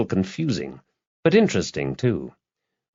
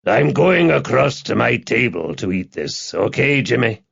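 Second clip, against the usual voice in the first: lightly voiced bar regular; 3 semitones higher than usual. A narrator's reading. Big man excuses himself to Jimmy at the bar, lightly voiced. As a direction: voice a hearty punter in a light character tone.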